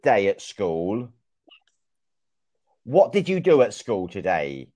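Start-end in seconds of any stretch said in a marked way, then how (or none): none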